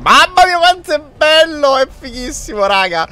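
excited high-pitched gush; thrilled exclamation. Real, not acted. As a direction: burst out thrilled. Thrilled by the map.

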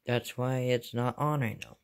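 ordinary talk, nothing marked

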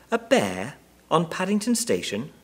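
high-pitched amazed question. A narrator's reading. Wife to husband on a bear at the station, amazed. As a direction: ask it in disbelief, pitch lifted high. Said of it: "looked at her husband in amazement".

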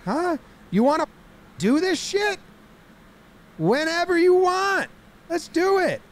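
high, stretched-out mock fighting shout; hammed-up confrontational yell; belligerent challenge. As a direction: goad them into a fight, voice way up. Acts out player challenging rival.

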